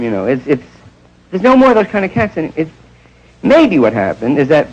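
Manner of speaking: ordinary talk, nothing marked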